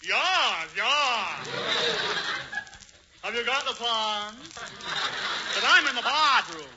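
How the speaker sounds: slow, put-on Hubert voice; pretending to be Hubert. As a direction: fake the rich man in the dark. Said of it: "make believe that you're Hubert Updike".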